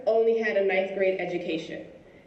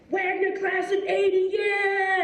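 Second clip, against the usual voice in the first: cheering alumnus; 10 semitones higher, slower than usual. Retold or imitated. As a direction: chant the school pride out. Alumnus cheers his college.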